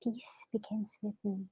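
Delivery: breathy whispered mantra. Shown as a demonstration. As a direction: whisper it gently to the kids. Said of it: "So I just whisper".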